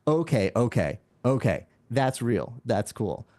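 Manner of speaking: low, measured concession; cynics grudgingly impressed. Retold or imitated. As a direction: imitate a sceptic slowly won over. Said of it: "Even the most cynical analysts are going".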